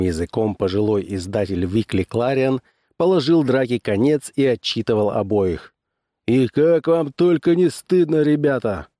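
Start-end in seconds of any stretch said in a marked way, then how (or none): 6.3-8.9 raised-pitch telling-off; scolding. A narrator's reading. Old publisher scolding two brawlers, reproachful. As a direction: shame the pair of them. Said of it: "выговаривал он".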